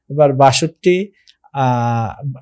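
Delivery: ordinary talk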